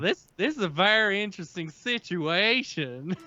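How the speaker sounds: put-on George Bailey in a Southern accent. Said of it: "currently George Bailey's voice".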